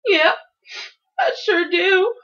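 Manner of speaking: high, loud, crying answer; bawling, drawn-out reply; tearful defiance. A narrator's reading. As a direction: wail it stubbornly through tears. Child answers through tears.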